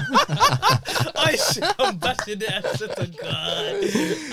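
cracking up, high and loud; high laughing outburst, words stretched out. Real, not acted. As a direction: talk through helpless loud laughter. Cracking up at own joke.

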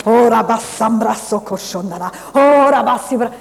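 loud, fervent praying in tongues, pitch climbing. Retold or imitated. Preacher pacing the house, praying aloud in tongues. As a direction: belt out the tongues like a revival preacher.

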